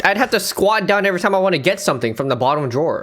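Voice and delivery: ordinary talk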